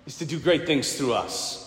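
ordinary delivery

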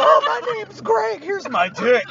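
loud, laughing mock introduction; hammed-up boast; mock intro. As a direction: blurt it out through laughter, slowly. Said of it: "And you're like".

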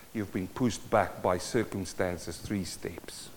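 very soft, low, solemn preaching; subdued, tender sermon voice with long pauses; hushed pastoral appeal. Real, not acted. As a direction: speak gently and low to hurting people.

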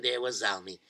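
ordinary delivery

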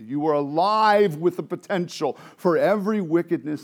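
loud, high preaching with stretched stresses; full-force preaching. Real, not acted.

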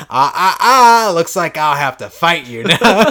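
slow loud wagging ah ah ah; teasing cop voice. Retold or imitated. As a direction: tease like a gotcha cop. Voicing Majima as cop.

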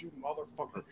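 soft curse through a laugh; retold amused curse. Tommy hearing the story at Daytona, amused soft cursing. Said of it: "Tommy was fucking dying".

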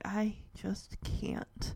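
slow, whispered surrender; low, quiet, drained resignation; utter defeat. Acted. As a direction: barely get the words out. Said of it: "Shadow just lays his head down".

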